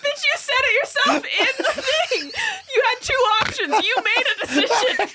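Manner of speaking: high-pitched amused outrage; squealed accusation through laughter; laughing mock scolding. Real, not acted. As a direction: tell them off while cracking up.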